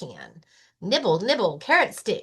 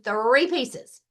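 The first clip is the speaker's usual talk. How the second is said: plain reading voice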